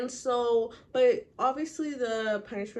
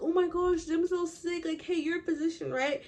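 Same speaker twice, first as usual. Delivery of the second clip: excited townsfolk; 8 semitones higher than usual. Retold or imitated. Townspeople plead with Chillingsworth.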